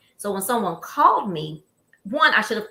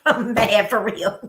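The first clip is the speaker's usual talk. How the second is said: louder, slow, laughing through the words; 4 dB louder, much slower than usual. Real, not acted. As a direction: laugh at your own anger as you say it. Laughing while retelling her anger.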